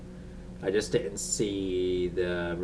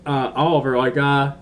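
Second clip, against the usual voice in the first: louder, laughing mock reminder; teasing heads-up; 4 semitones higher, 7 dB louder than usual. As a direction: playfully call out a forgetful hero, voice raised. Mock prompting the character, laughing.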